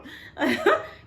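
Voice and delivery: bright rising laugh at her own story; burst of laughter. Real, not acted.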